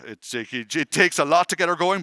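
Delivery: usual speaking voice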